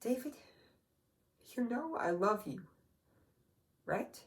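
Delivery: gently seek reassurance as a sad mother; tender, hesitant, with pauses. Acted. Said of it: "she had this sad look on her face".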